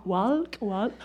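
hesitant, soft and low, with a questioning lift; imitating a puzzled listener piecing it together; working it out. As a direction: sound out the word tentatively, under your voice. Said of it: "Some of you are going".

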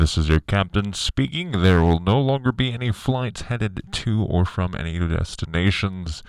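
ship tannoy announcement. Pretend. Mock pilot announcement.

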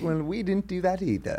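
mock Southern accent. Mom talking to someone, in a put-on Southern accent. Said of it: "mom does that sometimes".

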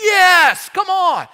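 high, loud exultant shout; newly baptized, shouting for joy; joyful shout. Retold or imitated. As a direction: cheer it out like a victory. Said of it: "it's like".

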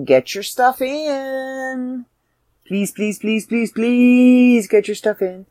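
high, slow, drawn-out begging; sing-song please, please, please; pleading. Real, not acted. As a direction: plead with them like it really matters. Begging listeners for submissions.

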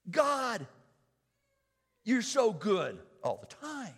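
loud, high prayerful praise; fervent prayer. Acted. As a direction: pray it out with full fervour. Opening prayer at full force.

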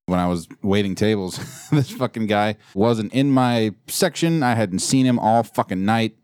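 usual speaking voice

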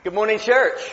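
loud, high greeting to the room; calling out a greeting. Real, not acted. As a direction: call out to the whole church. Preacher greets congregation.

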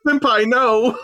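high hammy anime cry; mock anime plea. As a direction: squeal it like an anime girl.

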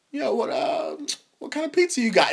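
high-pitched, casually fishing dad; nosy dad. Retold or imitated. Dad eyeing his son's pizza, lightly mocked nosy question. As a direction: impersonate a dad feigning casual interest. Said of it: "and he's like".

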